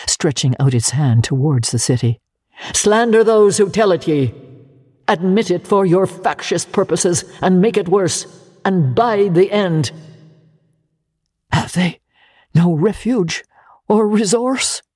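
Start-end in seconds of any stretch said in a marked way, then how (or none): 2.7-9.9 crying out. Acted. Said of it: "cried the spirit". 11.5-14.8 anguished cry. Acted. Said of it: "cried Scrooge".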